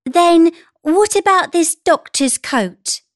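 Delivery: high, lifted mother voice; enthusiastic mother. A narrator's reading. Mother offering her daughter another costume, enthusiastic. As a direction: pitch it high and encouraging.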